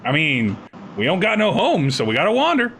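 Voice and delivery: high-pitched rough lizard drifter; lizard man voice. Acted.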